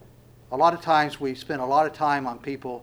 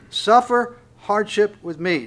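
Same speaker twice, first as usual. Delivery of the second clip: slow, louder, weighty scripture reading; slowed for emphasis; 5 semitones higher, 5 dB louder, slower than usual. Real, not acted. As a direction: lean hard on SUFFER, then ease through the phrase. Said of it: "I just want to slow down at this point".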